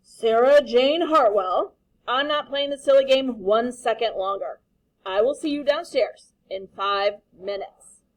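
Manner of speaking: stern father. Acted. Father scolding his daughter, loud stern voice. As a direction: lay down the law like a cross dad.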